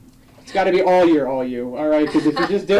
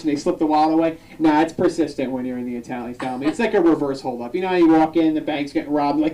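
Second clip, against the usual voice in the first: ordinary talk, nothing marked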